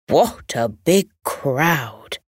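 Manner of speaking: lightly voiced kindly grandad; a hint of a slow old grandad; Grandad's voice. A narrator's reading. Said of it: "Grandad said".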